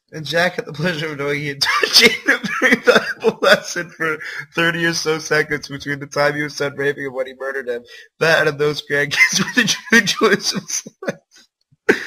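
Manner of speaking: can barely get the words out for laughing; incredulous giggling read; laughing while reading. Real, not acted. Reading an absurd ending aloud.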